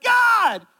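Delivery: a loud, high shout of "God!" at the sky; desperate cry to God. Retold or imitated. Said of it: "And I'm like".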